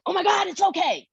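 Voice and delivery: high-pitched mock panic; panicky reassurance. Shown as a demonstration. Demonstrating to pet owners the wrong way, panicky. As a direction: overdo the alarm, voice shooting way up. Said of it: "That is not what I mean".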